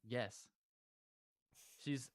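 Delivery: normal talking voice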